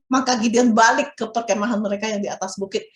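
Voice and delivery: ordinary delivery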